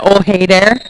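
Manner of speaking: folksy Minnesota "Oh, hey there" with a rising end; teasing Minnesota greeting, drawn-out "hey"; mock Minnesota accent. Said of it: "Is that a".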